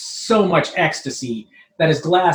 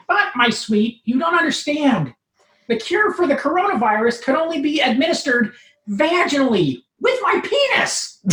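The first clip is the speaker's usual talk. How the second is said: much higher, whiny nerd protest; pleading Urkel; 9 semitones higher than usual. Acted. Steve explains the cure.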